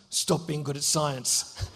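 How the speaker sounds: low, slow menacing growl of a schoolboy bully. Retold or imitated. Bully orders the boy to stop, low and slow. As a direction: lean in low and slow, threatening. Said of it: "He goes".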